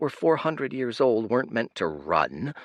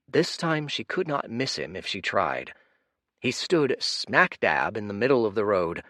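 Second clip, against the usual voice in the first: usual speaking voice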